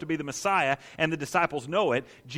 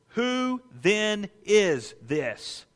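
WHO THEN IS THIS, very slow and higher, word by word; "Who then is this" as a weighty, spaced-out question; emphatic question; 4 semitones higher, much slower than usual. Acted. Preacher stresses the gospel's recurring question.

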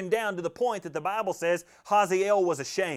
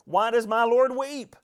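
"Why does my lord weep?" asked higher, louder and slow, stretching "lord"; concerned question; 7 semitones higher, 5 dB louder, slower than usual. Shown as a demonstration. Preacher voicing Hazael's alarm at the weeping prophet, higher, louder and slow. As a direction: ask it high, loud and slow like a worried man. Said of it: "Hazael was just as concerned".

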